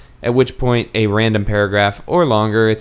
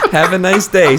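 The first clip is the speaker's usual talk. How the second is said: laughing catchphrase; 6 semitones higher than usual. Retold or imitated.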